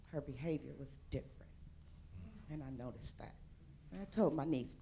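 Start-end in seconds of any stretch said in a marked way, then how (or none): none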